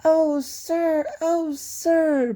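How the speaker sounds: high, breathless joy of a small engine; bubbling ecstasy. A narrator's reading. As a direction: bubble over with delight, high up. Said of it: "bubbled Peter Sam in ecstasy".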